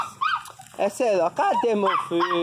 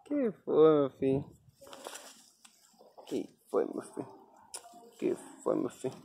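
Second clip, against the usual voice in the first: fading to whisper; 7 semitones lower, 11 dB quieter, faster than usual. Real, not acted. Fading repeated question to dog.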